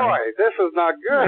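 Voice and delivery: loud, laughing disbelief. Real, not acted. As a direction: say it while cracking up. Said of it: "We're both laughing".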